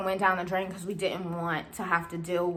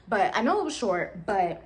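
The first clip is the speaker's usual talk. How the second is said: ordinary talk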